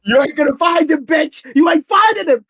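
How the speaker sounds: high, loud hammed-up jeer; mocking taunt. Guest taunts hosts hunting the real him, high jeering shout. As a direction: gloat that they'll never find him.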